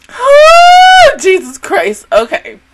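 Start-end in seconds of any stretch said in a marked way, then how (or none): 0.1-1.2 high loud drawn-out groan; exasperated oh. Real, not acted. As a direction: let the frustration burst out. Reaction to the cliffhanger.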